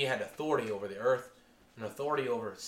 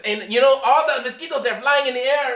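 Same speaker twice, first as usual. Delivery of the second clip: put-on high, animated Filipino English accent, telling a tall tale; 11 semitones higher, 14 dB louder than usual. The Filipino pastor brags about commanding a cloud, high Filipino accent. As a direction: retell the story as the Filipino pastor in his accent. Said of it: "he used the Filipino accent".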